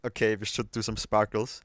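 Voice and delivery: normal talking voice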